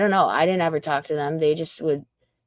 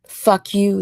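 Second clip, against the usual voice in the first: quoting Ethan; 3 semitones higher, 7 dB louder than usual. Retold or imitated. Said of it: "I'm just quoting Ethan".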